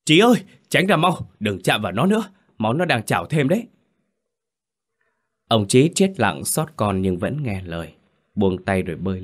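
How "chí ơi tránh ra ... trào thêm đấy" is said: put-on old man yells at the father not to touch the body; sharp scolding shout. A narrator's reading. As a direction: bark the warning to pull him back. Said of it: "Ông Đậu vội quát".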